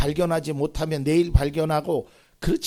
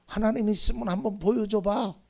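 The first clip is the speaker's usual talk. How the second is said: raised-pitch imitation of a scoffer; acted taunt, pitched up; mocking challenge; 7 semitones higher than usual. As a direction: goad them like a jeering doubter. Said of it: "그러면서 비웃는 거예요".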